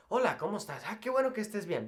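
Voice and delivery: rattled off quickly; fast Spanish. Shown as a demonstration. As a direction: rush through the greeting. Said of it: "you don't need to talk like that".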